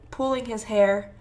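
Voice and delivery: ordinary delivery, nothing marked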